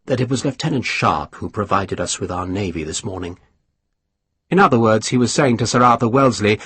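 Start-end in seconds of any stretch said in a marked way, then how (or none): 0.1-3.4 low and soft, a quiet reminder; softly. Acted. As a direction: murmur it under the table talk. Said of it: "he said softly".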